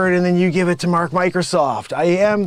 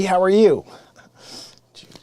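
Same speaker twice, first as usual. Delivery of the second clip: ordinary talk, nothing marked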